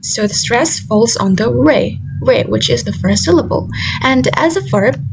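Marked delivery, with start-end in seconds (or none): none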